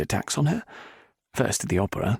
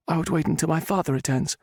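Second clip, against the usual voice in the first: higher woman's voice; heroine; 9 semitones higher than usual. A narrator's reading. Heroine stalling the Viscount. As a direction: stall him politely.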